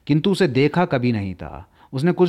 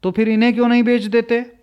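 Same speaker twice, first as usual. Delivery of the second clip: put-on eager girl; 8 semitones higher, 3 dB louder, faster than usual. A narrator's reading. Girl's eager question.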